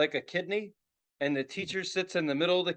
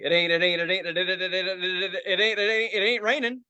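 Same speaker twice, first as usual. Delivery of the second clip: mock stutter. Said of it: "think of stuttering".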